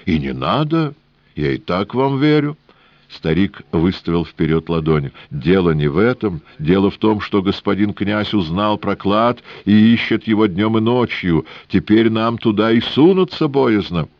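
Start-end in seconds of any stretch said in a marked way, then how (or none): 0.1-2.6 put-on high, sing-song Yiddish-accented old man; wheedling old trader with Yiddish lilt. 5.3-14.1 put-on high, sing-song Yiddish-accented old man; wheedling old trader with Yiddish lilt.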